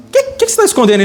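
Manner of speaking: high-pitched acted questioning; probing question. Preacher playing Jesus asking what is hidden, high probing question. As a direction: jump up high as you press for the truth.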